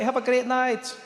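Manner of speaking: put-on high, loud Indian-accented waiter. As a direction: call out a farewell in an Indian accent. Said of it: "you, you Indian?".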